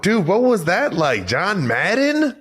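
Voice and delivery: high, excited fan questioning; starstruck excitement. Real, not acted. Fan asking about meeting his hero John Madden, starstruck. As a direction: gush with real excitement, pitch way up.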